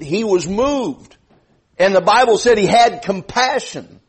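high, ringing sermon delivery; fervent preaching. Real, not acted. Preacher urging church to help send missionaries, fervent preaching.

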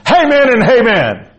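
very high, loud pulpit holler; shouted amens. Real, not acted. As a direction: holler it out to rouse the church. Preacher calls out amens.

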